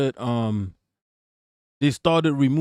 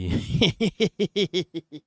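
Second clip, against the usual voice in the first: high staccato giggle; a run of quick high-pitched laughs; teasing laugh; 6 semitones higher than usual. Real, not acted. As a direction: laugh at your own teasing joke. Joking about viewer logging off.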